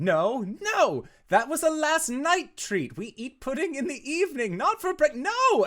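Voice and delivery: high-pitched, loud mom protest; frantic scolding. Acted. Mother chasing her son over breakfast pudding, frantic shouting. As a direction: shout it in rising panic, cut yourself off. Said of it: "followed up with [name]'s voice saying".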